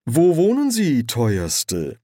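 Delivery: high, loud start sliding down politely; pointedly friendly. A narrator's reading. Angel asks the young woman where she lives, pointedly friendly. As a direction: lay on the friendliness. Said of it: "fragte Erziraphael betont freundlich".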